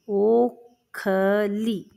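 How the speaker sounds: stretched syllable by syllable; ओखली drawn out for dictation; slow dictation. Shown as a demonstration. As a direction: spell out ओखली slowly as ओ-ख-ली. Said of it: "इसका नाम है बच्चों ओखली".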